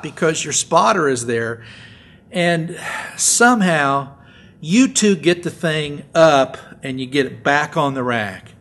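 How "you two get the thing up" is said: ordinary talk, nothing marked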